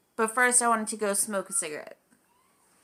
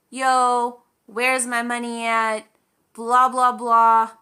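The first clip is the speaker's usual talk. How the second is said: retold yelling; 2 semitones higher, 7 dB louder, slower than usual. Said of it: "starts yelling at Josh".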